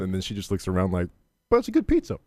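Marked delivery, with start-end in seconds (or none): 1.5-2.2 mock girl voice.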